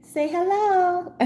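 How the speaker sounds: high, soft, slow coaxing; sweet pet voice. Real, not acted. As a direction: coo at the kitten slowly. Talking to the kitten.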